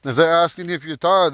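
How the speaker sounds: usual speaking voice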